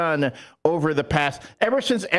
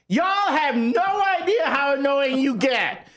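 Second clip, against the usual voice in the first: indignant rant; 7 semitones higher than usual. Real, not acted. Ranting back at Packers fans.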